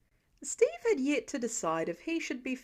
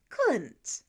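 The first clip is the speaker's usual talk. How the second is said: chiding. A narrator's reading. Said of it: "Steve chided the man".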